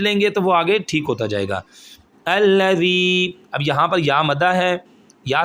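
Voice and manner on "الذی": الذی sung slowly, the final ی drawn out one alif long; recited with a stretched vowel. Shown as a demonstration. Quran teacher reciting الذی for students, vowel stretched. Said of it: "مدہ کا مطلب ہوتا ہے کھینچ کر پڑھنا".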